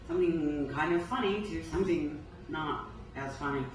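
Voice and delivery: ordinary talk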